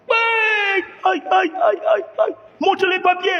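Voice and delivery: very high, far-off yelps of pain, aïe aïe; cries of pain. Acted. Beaten migrant yelping under the machete blows. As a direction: yelp like a man struck with a machete.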